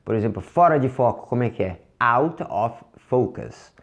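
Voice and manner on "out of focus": slow, separated "Out of focus"; word by word. Shown as a demonstration. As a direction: say "Out of focus" slowly, each word apart. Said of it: "Direto seria out of focus". ("Out of focus" devagar, palavra por palavra)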